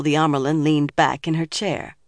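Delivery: usual speaking voice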